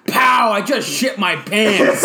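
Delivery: slow shouted Maron bit; Marc Maron impression. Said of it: "he goes".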